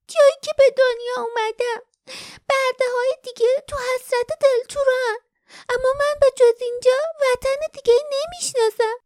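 put-on high young girl's voice, wistful; young sweet voice. A narrator's reading. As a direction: speak like a homesick young girl. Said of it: "صدا آه کشید".

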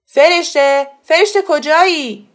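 loud, high call through the house; shouting for someone. A narrator's reading. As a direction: holler her name to find her. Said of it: "و داد زد".

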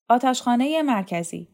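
usual speaking voice

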